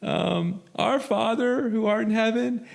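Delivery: put-on high, slow, timid girl voice; a nervous newcomer squeaking out the closing; a shy young woman, high and slow, running her first meeting; timid little girl. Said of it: "But she goes".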